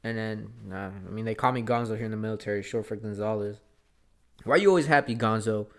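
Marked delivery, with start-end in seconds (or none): 4.4-5.7 louder, pointed question, almost an accusation; accusing tone. Retold or imitated. Army buddy confronting him about always being happy. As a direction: throw the question at him like a challenge. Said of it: "Like that".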